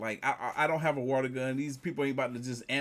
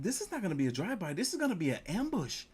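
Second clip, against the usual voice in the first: higher, fast nervous realization; mock panic; 8 semitones higher than usual. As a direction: hurry through it like panic is rising. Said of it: "I was like".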